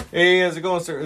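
loud, high mock greeting; boss impression. Acting out the exchange at the counter, loud high impression. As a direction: call it out in a high, loud put-on voice. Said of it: "He's like".

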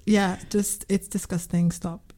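usual speaking voice

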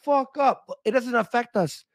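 mock-high scornful comeback to critics; dismissive retort. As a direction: brush them off in a mock high voice. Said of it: "and we're like".

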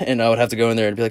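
ordinary talk, nothing marked

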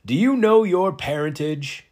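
loud, high ceremonial proclamation; booming formal question. Acted. Presiding figure questions newcomer Lars about his parentage, booming and formal. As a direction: call it out slowly to the whole hall.

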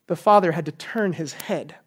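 ordinary talk

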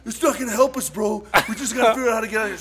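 high, loud, exasperated retort; exasperated friend. Retold or imitated. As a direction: argue back over the panic. Friend snaps back during quarrel.

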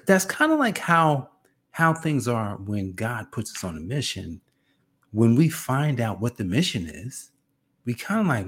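usual speaking voice

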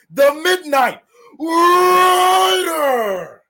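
booming ring announcer build-up; hype intro. Pretend. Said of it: "just do the intro".

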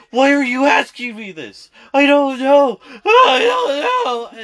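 high, loud imitated wail, building; mock crying. Megan freaking out at the questions, mock crying. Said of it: "doing her whole crying bit".

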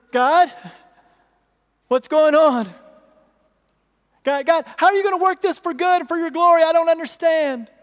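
loud, high, fast plea to God; anguished cry to God. Acted. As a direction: shout your confusion up to God. Voicing believer crying to God.

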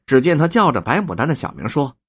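usual speaking voice

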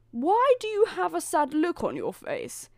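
high, loud queen questioning her son. A narrator's reading. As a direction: put on a high-pitched queen mother. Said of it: "said the queen to her son".

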